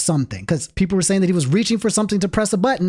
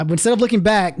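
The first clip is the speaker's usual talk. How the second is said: usual speaking voice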